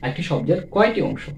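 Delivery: usual speaking voice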